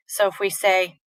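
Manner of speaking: normal talking voice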